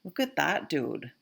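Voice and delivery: Vermont accent. Shown as a demonstration. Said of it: "That would be something you'd hear in Vermont".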